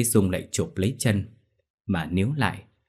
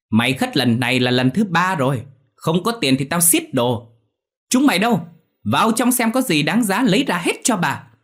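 high, loud angry tirade; shrill yelling; 9 semitones higher, 5 dB louder than usual. A narrator's reading. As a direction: screech the demands at a debtor. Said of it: "chóe chóe lên quát tiếp".

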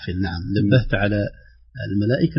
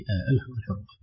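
normal talking voice

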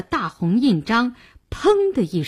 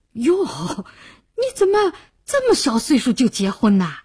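higher-pitched nosy exclamation; surprised, curious; 3 semitones higher than usual. Acted. Clerk questioning a very young groom, surprised. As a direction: act taken aback, pitch jumping up. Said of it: "不禁有些奇怪".

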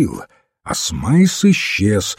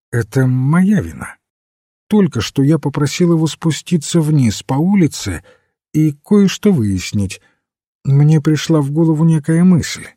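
higher-pitched, gently apologetic priest; mild priest character; 6 semitones higher, 4 dB louder than usual. Acted. Narrator voices Father Brown. (священник, высоким голосом)

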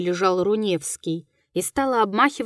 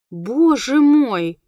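louder, drawn-out exclamation; exclaiming; 3 semitones higher, 5 dB louder, much slower than usual. A narrator's reading. Руневский to the ghostly woman, exclaiming. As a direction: cry out slowly in dismay. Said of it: "воскликнул Руневский".

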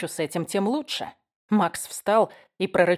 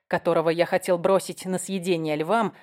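ordinary delivery, nothing marked

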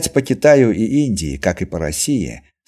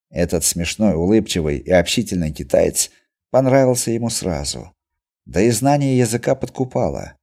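ordinary delivery

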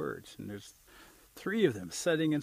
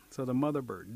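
ordinary delivery, nothing marked

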